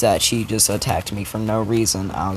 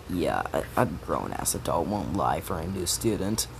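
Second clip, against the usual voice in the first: low, soft, dry teacher voice; deadpan teacher; 6 semitones lower, 7 dB quieter than usual. Retold or imitated. Teacher to Bakugo, low and dry. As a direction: drop it low and unimpressed. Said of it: "the teacher's like".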